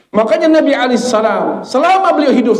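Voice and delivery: high, driving sermon delivery; impassioned preaching. Real, not acted. Preacher telling of the Prophet's lifelong prayer, impassioned. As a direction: proclaim it high and urgently. Preacher exhorts congregation at height.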